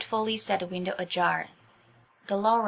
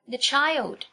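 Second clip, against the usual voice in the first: shriek; 6 semitones higher than usual. A narrator's reading. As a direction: cry out in alarm for the child. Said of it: "shrieked the maids".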